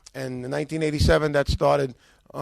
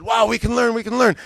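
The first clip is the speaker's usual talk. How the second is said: higher, louder delighted outburst; excited discovery; 5 semitones higher, 5 dB louder than usual. Retold or imitated. Husband leafing through the new book, excited. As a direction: blurt it out like a find.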